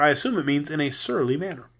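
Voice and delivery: plain reading voice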